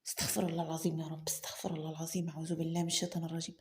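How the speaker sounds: hushed, hurried whisper of repentance; fast whisper, like a man fighting his own thoughts; whispered prayer. Acted. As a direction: rush the prayer under your breath, barely voiced. Selim anxiously seeks refuge inwardly.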